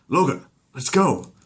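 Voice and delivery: high, slow loud call. Acted. Exterminator calling Logan to move out, loud and high. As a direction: rouse the team.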